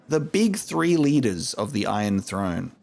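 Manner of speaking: normal talking voice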